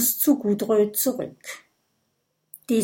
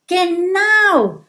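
high, louder, joyful exclamation; 9 semitones higher, 6 dB louder than usual. Acted. Kokosnuss joyfully confirming his idea to friends, excited. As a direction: burst out with happy excitement. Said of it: "sagt Kokosnuss freudig".

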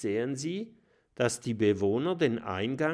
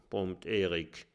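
ordinary delivery

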